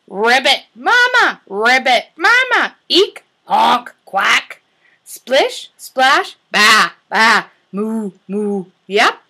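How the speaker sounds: bark, bleat and tweet each animal in turn; animal sounds. Acted. Teacher voicing farm animals for toddlers.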